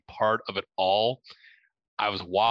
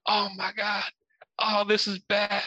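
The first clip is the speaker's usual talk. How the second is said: panicked; 9 semitones higher than usual. Retold or imitated. Panicking alone in the room.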